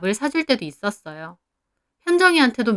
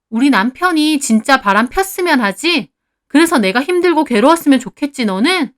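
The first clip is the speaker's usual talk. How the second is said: raised, pointed, indignant voice; loud, higher-pitched accusing tone; angry accusation; 5 semitones higher, 5 dB louder than usual. A narrator's reading. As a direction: confront a spiteful friend. Wife accuses friend in acted quarrel.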